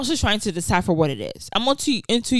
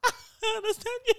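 laughing; 12 semitones higher, 8 dB quieter than usual. Retold or imitated. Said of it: "And he's like".

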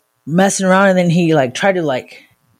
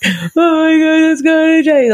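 exaggerated tearful whine, pitched way up; mock crying; 10 semitones higher than usual. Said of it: "[name]'s fucking literally crying".

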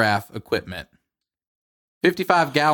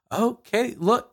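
high nervous voice; 11 semitones higher, slower than usual. Retold or imitated. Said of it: "I'm just like".

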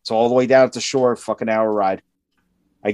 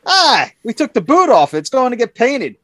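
high, breezy imitation of the foreman; mimicking the foreman; 11 semitones higher, 3 dB louder than usual. Mocking the foreman's excuse to a friend, high and breezy. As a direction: toss it off in a higher, careless boss voice. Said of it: "He goes".

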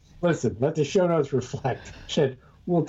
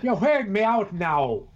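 much higher mock British scold; scolding wife; 8 semitones higher than usual. Imagined wife scolding him for talking British, high scold. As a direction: tell him off like an exasperated wife. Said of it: "My wife would say".

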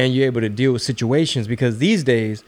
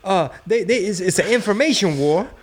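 much higher, nervous pleading voice; hammed-up pleading; 7 semitones higher than usual. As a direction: beg the intruder to hold on. Acting out talking to intruder.

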